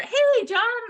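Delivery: high, loud greeting with a smile; smiling hello. Shown as a demonstration. Trainer showing where to smile, smiling phone hello. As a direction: beam as you hail the client. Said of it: "smile when you say hello".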